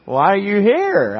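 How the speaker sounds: a hint of the suspicious professor; curious question. Professor quizzing the pastor, lightly voiced curious.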